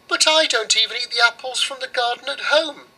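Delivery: put-on high, proud little girl; girl's voice. Girl scorns the oven's rye pies, high and haughty. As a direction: turn the pie down with a toss of the head. Girl refuses the apple tree.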